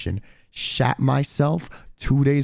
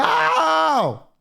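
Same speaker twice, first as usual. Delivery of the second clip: voice test; 14 semitones higher, 4 dB louder than usual. Real, not acted. Testing voice after clearing throat.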